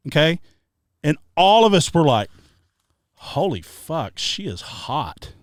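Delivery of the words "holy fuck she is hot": slow, soft, awed under-breath; hushed awe. Retold or imitated. Drunk friends awed as woman walks in. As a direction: murmur it, stunned by her.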